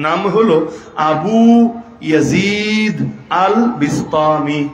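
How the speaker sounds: আবু ইয়াজিদ আল বিস্তামি spelled out slowly, word by word with pauses; careful name pronunciation. Shown as a demonstration. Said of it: "মনে থাকবে".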